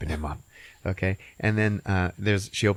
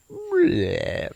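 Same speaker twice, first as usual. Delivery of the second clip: gross bleh. Retold or imitated. Hot dog peels over.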